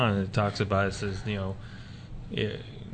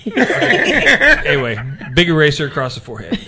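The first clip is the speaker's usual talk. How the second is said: laughing; 4 semitones higher, 8 dB louder than usual. Real, not acted. Loud laughing after the joke.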